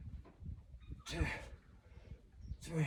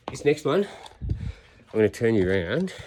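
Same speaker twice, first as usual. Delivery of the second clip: ordinary talk, nothing marked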